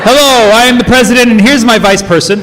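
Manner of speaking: high, loud pompous announcement; mock president intro. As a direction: introduce yourself like a grand president. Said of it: "is that the technical position?".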